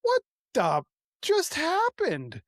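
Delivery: high, slow, bewildered; stunned disbelief. Retold or imitated. After the father-in-law falls weeping, slow stunned disbelief. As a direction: let it sink in slowly, baffled.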